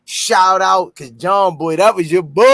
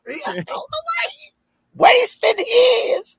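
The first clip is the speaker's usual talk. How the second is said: mock whining. Mock whining about sponsors' time.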